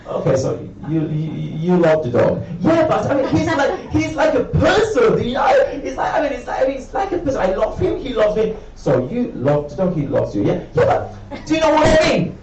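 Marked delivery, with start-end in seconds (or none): 2.6-8.6 very high, squealing gush about the dog; gushing dog owner. Retold or imitated. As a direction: gush like a besotted pet owner.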